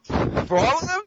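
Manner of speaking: high, shocked repeat of the question; can't believe what he just heard; incredulous question. Real, not acted. Shocked at friend's voice preference.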